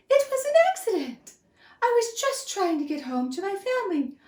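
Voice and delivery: high, squeaky begging; a tiny mouse begging for mercy; pleading mouse. Acted. Mouse begs the angry lion, high and pleading. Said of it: "he cried".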